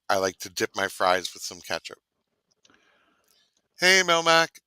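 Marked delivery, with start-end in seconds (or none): 3.8-4.6 call-out. Retold or imitated. Sudden call-out, ALF catchphrase.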